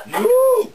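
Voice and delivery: high, loud mooing cry; cow moo. Retold or imitated. Storyteller as the cow cut loose from the rope, high loud moo. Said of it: "the cow goes".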